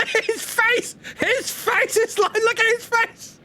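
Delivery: very high, giggly squeals of delight; real laughter, squealing high; laughing squeal. Real, not acted. Cracking up at Mark's face.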